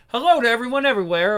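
a mock announcer hello, sped up; fast hammy greeting. Shown as a demonstration. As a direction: rush through the hello like a showman. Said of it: "we'll do this one again".

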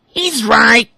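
very high, loud, slow duckling voice; quacking ducklings. A narrator's reading. Ducklings siding with their brother, high. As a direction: back up your brother smugly. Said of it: "Up quacked the other ducklings".

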